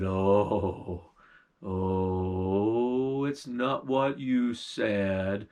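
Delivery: low, slow, sulky bear; the bear accusing the witch, low and heavy; old bear voice. Acted.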